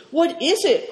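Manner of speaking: high alarmed cry; alarmed cry in the dark passage. A narrator's reading. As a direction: call out in sudden alarm. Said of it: "cried Moonlight in alarm".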